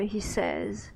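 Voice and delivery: ordinary talk, nothing marked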